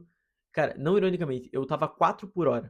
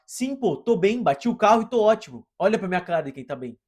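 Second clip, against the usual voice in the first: put-on high, loud, sarcastic snap at the old man; imagined furious reply to the old man, loud and sarcastic; sarcastic angry outburst; 5 semitones higher, 8 dB louder than usual. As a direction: blurt it out furious and mocking. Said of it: "a minha vontade era de falar".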